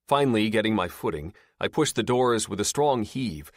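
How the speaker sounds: ordinary delivery, nothing marked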